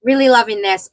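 normal talking voice

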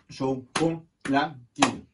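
slow "Chocolatine" in four even pieces; syllable by syllable. Shown as a demonstration. Teacher recaps after counting four, syllable by syllable. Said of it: "Quatre syllabes".

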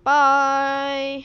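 loud, long-held goodbye; drawn-out, loud sing-out goodbye; sign-off call. Real, not acted. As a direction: call out a stretched farewell. Signs off the episode.

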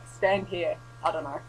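usual speaking voice

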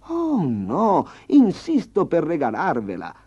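high, loud, fawning peasant voice; bow and scrape with a high eager voice; flattering farmer. Acted. The farmer flattering the tiger, high and loud fawning.

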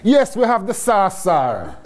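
hammed-up Jamaican, starting high; Jamaican accent. Entrepreneur telling the chief the sauce is ready, in exaggerated Jamaican. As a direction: lay the Jamaican accent on thick. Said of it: "in my best Jamaican voice".

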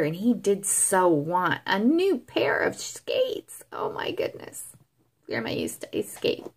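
steady storybook reading voice; story narration. A narrator's reading. Grandma reading a Christmas story to grandchildren, storybook voice. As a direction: read it aloud to the grandkids.

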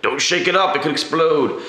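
high, alarmed warning voice; mock alarmed warning. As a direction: pretend it might blow up. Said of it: "It's like".

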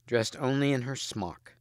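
plain reading voice

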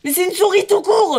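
alarmed shout. Real, not acted. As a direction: yell it out, startled. Panique devant un rat. (cri alarmé)

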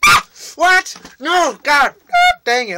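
loud, high-pitched shrieks; screaming. Real, not acted. Player killed in the game, screaming. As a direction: shriek it out in frustration. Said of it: "it's all the screamings".

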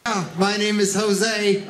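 ordinary talk, nothing marked

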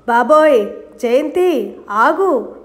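plain reading voice

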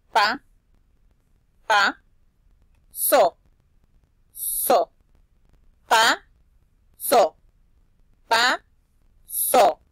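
pa and so as isolated loud syllables; syllable drill. Shown as a demonstration. Teacher drilling syllables of pasó, loud separate pa and so. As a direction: punch out each pa and so, a pause after each.

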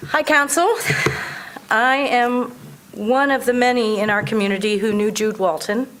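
ordinary talk

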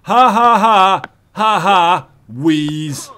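slow, high, loud fake cackle then a wheeze; mocking laugh. Gamer mimicking Bennett Foddy laughing at him, slow mocking cackle. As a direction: cackle like the smug designer. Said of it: "Laughing at us".

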